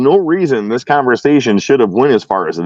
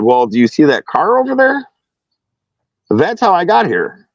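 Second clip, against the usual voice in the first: higher-pitched, pointed retort; mildly testy, spelling out the obvious; a little upset; 6 semitones higher than usual. Retold or imitated. Driver answering officer on how he got here, a little upset. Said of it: "now I'm a little upset".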